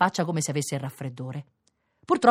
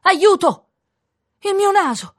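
much higher, loud shout; panicked cry for help; 8 dB louder, slower than usual. A narrator's reading. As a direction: cry out in alarm, pitched way up. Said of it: "nel guardarsi allo specchio gridò". (grido acuto d'aiuto)

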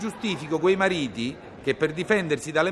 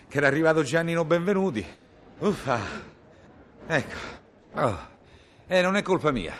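ordinary delivery

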